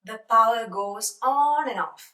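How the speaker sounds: very slow, words spaced apart; slow teaching demo. Shown as a demonstration. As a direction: lay the phrase out for learners. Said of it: "എന്ന് പറയണമെങ്കിൽ".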